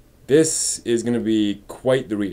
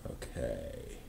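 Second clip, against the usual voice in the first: whispered mutter; 14 semitones lower, 20 dB quieter, much slower than usual. Real, not acted. Muttering to self, working.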